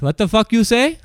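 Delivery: loud, high retold confrontation; mock angry challenge. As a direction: imitate someone rising to a fight. Retold angry challenge before fight.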